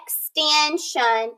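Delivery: "extension" slow with clear "shun" ending; slow teacher model; slow modelled word. Shown as a demonstration. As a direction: stretch it out for the class. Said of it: "it's gonna say shun".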